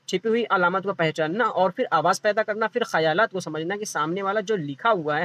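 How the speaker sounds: usual speaking voice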